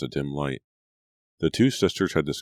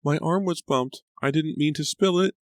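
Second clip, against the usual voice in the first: frightened; 13 semitones higher, 4 dB louder than usual. A narrator's reading. Frightened maid pleads innocence.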